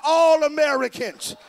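loud rally call; loud call to the crowd. Real, not acted. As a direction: call it out so they answer back. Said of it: "Somebody shout".

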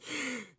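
sharp, high gasp of disbelief; shocked little gasp at the idea. Acted. Reacts to absurd wish.

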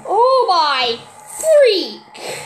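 startled exclamation. Real, not acted. Sudden fright.

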